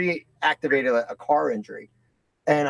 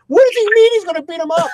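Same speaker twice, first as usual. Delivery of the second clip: put-on much higher and louder child voice; worried daughter; 14 semitones higher, 8 dB louder than usual. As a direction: play the alarmed young daughter, pitched way up. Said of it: "so she's like".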